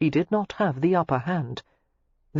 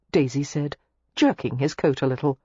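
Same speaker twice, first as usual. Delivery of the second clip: ordinary talk, nothing marked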